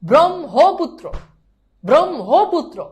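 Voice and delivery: loud, slow ব্রহ্মপুত্র in swooping syllables; teacher drills ব্রহ্মপুত্র, each syllable stretched; emphatic pronunciation drill. Shown as a demonstration. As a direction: chant out "brom-ho-putro" for ব্রহ্মপুত্র. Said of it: "কি উচ্চারণ বলো তো?".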